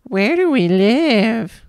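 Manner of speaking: high, slow, mocking mimicry of a child. A narrator's reading. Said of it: "he said in his high, mocking tone".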